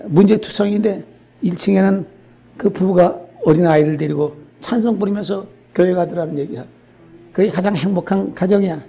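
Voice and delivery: ordinary talk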